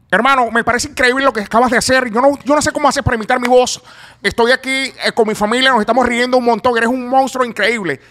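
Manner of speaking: loud, high-pitched imitation of a TV interviewer; Luis Olavarrieta. Impressionist acting out the interviewer's reply to his video, imitation voice. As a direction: belt out amazed praise in a high put-on voice. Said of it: "¿Te puedo imitar diciendo cinco palabras".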